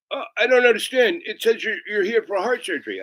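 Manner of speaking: confused manager. Retold or imitated. Manager reads the scanned barcode.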